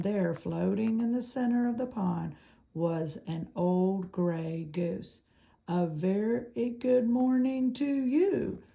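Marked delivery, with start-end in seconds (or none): 5.7-8.6 cheerful, booming robot greeting; friendly robot voice. A narrator's reading. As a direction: call out brightly across the water. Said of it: "the robot's friendly voice boomed".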